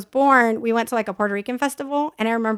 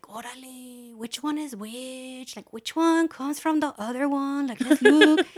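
greaser quote; 5 dB quieter, slower than usual. Retold or imitated. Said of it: "they're like".

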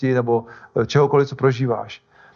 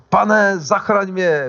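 slow, higher-pitched mock cry for help; retold cry out; 5 semitones higher, much slower than usual. Sinking Peter crying to the Lord, drawn-out retold cry. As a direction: call out as if sinking, mildly acted. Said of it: "vykřikl".

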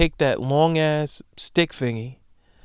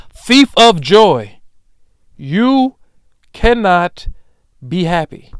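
slow, loud, high cry of thief of joy; a drawn-out, loud thief of joy; yelled accusation; 5 semitones higher, 11 dB louder, much slower than usual. Real, not acted. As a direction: bellow it out like a verdict. Rant climax, accusing listener.

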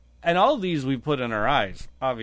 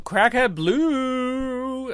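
drawn-out announcement; 7 semitones higher, much slower than usual. Acted. Announcing the list owner.

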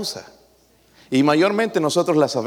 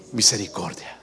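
súplica suave; 9 semitones lower, 8 dB quieter than usual. Retold or imitated. Psalmist's plea voiced.

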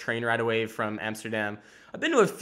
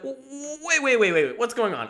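stammering, much higher, half-laughing; mock confusion; 10 semitones higher than usual. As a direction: play a baffled character catching on. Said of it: "it starts to be like".

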